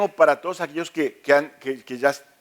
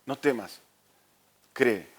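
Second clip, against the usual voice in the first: the same words dropped much softer, almost tender; softer reassurance; 4 semitones lower, 7 dB quieter, slower than usual. Acted. Jesus gently repeating to Jairo, much softer and tender. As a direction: lean in and let it fall quiet.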